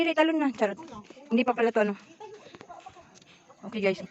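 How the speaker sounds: usual speaking voice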